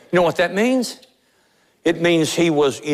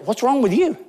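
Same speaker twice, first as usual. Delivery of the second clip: mild mock scold, higher; retold scolding question; 8 semitones higher than usual. Pastor retelling friends dismissing the upset man, mild mock. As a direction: toss it back like a dismissive friend. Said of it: "they looked at him and said".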